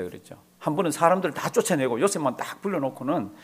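normal talking voice

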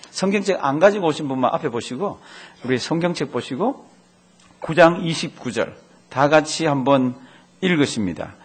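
ordinary talk

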